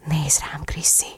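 very soft, intimate whisper; sensual whisper. A narrator's reading. Lover asking Chrissy to look, sensual whisper. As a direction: breathe it close to a lover's ear. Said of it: "suttogta érzékien".

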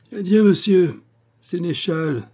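high, loud, drawn-out cutting farewell; piercing, slow mocking send-off; incisive yelp. A narrator's reading. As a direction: yelp the goodbye with biting scorn. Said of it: "glapit le notaire de sa voix la plus incisive".

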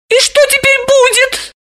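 high, squeaky scared woman; frightened squeak. A narrator's reading. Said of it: "испуганно пропищала она".

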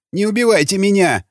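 high-pitched frightened begging; trembling plea. A narrator's reading. Captured gunner begs raiders for mercy, trembling plea. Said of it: "задрожал черновик".

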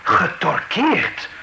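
slow, rising disbelief at the word; incredulous echo. Acted. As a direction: drag it out, stunned and questioning. Accused echoes the word torture.

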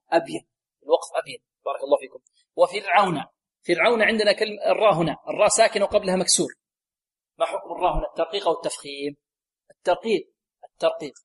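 ordinary delivery

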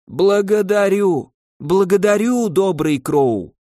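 put-on high, slow old man voice. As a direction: repeat thanks like a trembling old beggar.

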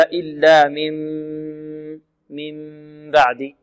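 slow إلا من بعد with a long hummed m before the b; lips lightly closed. Shown as a demonstration. Teacher modelling the correct reading for students, long soft nasal hum. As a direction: hold the nasal in من بعد, lips gently together. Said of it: "هكذا".

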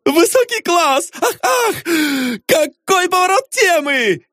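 very high, giggly exclamation; gleeful falsetto gushing with "ah"s; tickled delight. A narrator's reading. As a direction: squeal with delighted admiration. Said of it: "заливаясь смехом, произнёс".

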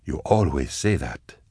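soft, slow and gentle; calm him like a worried keeper; softly soothing reply. A narrator's reading. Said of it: "Goblika said soothingly".

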